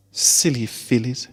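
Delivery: slow, fond sigh. A narrator's reading. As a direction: let it out with a weary sigh. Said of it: "she sighed".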